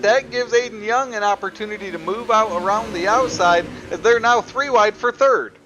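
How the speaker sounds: excited race call, rising pitch; building excitement over the contact; motorsport commentator. Real, not acted.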